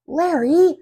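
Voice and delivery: high, loud call. Acted. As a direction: call the bird back. Girl calls her bird.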